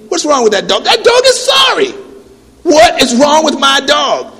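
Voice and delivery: high, loud mock outrage; indignant outburst. Pete defending his dog to Norm, indignant shout. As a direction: shout back in disbelief.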